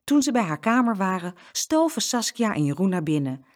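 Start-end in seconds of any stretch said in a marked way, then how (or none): none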